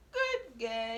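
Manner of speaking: ordinary delivery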